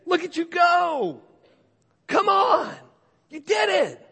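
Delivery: put-on high, loud, slow cheering for a child; cheering excitement. God cheering a believer, high and excited. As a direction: cheer them on like a proud parent. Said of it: "God's up there saying".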